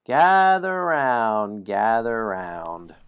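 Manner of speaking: slow, loud drawn-out call to gather; storyteller call. Acted. Storyteller calling players to gather for the tale, slow and loud. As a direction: summon the listeners like a bard. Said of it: "I've got some story".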